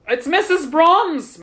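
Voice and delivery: high, loud, slow exclamation; startled exclamation. A narrator's reading. Superintendent turns toward disturbance, startled exclamation. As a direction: exclaim on recognizing her, short of screaming. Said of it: "Mister Bozeman said, turning toward the disturbance".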